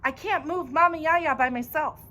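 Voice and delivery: high, urgent shouted plea; desperate shout; screamed plea. A narrator's reading. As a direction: yell at him for help. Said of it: "I scream at him".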